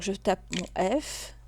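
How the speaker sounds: ordinary talk, nothing marked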